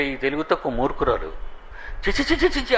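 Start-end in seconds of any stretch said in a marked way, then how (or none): 2.1-2.8 high mock tutting of disgust; pitched up, lightly mocked disgust; disgusted husband. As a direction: cluck your tongue high and scornful. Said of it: "అంటున్నాడు ఆయన".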